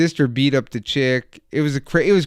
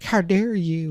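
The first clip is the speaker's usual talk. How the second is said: high-pitched mock indignation; put-on offended voice, pitched up; mock outrage; 6 semitones higher, slower than usual. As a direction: imitate scandalized friends. Imitating friends' outrage at him.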